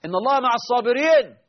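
high, loud إن الله مع الصابرين called to the imam; hammed-up loud call to the imam; mock clapping call. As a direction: call out to make the imam wait. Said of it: "بعضهم يصفق".

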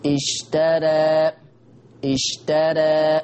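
اشترى drawn out very slowly with the alif tilted toward e; reading with taqlil. Shown as a demonstration. Teacher demonstrating one way people recite. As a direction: stretch اشترى slowly, alif half-leaning. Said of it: "في ناس بيقولوا إزاي بقى".

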